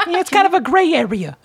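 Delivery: very high, squeaky hustler voice; Danny Deep Pockets. Acted. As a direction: play a slick, grinning money changer. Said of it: "they call me Danny Deep Pockets".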